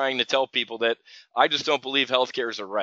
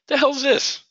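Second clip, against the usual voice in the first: much higher and louder, incredulous; baffled outburst; 12 semitones higher, 4 dB louder than usual. Real, not acted. Watching a flash crash on his screen, high disbelieving outburst. Sees sudden crash in vol.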